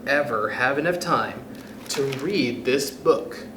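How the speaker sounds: ordinary delivery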